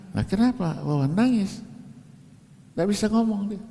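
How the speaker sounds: ordinary talk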